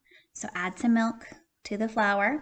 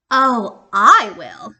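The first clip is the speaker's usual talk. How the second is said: loud, eager "I will"; eager goose; 12 dB louder, much slower than usual. Acted. Goose to hen, loud eager yes. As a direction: jump at the chance.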